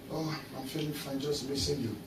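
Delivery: very soft, low lovesick coo; hushed mock-romantic reply; mock sweet reply. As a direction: murmur it like a lovesick texter. Mocking lovers' chat reply.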